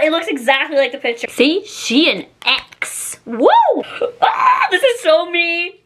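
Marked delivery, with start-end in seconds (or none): 4.2-5.8 slow, higher exaggerated gush with a laugh; put-on gushing voice. Shown as a demonstration. Vlogger unboxing a top, gushing in a put-on voice. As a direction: ham up the excitement in a funny put-on voice. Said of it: "I don't know why I'm talking like that".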